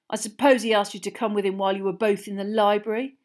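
fast, loud sarcastic needling; accusing. A narrator's reading. Boy jealously questioning his friend about her date, accusing. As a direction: rattle off the accusation loudly.

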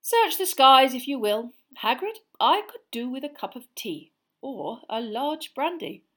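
amused. A narrator's reading. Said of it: "still sounding amused".

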